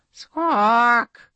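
a princess faking a bird call; bird squawk. Acted. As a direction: squawk in a strange voice.

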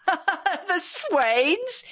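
roaring with laughter. A narrator's reading. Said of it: "he roared".

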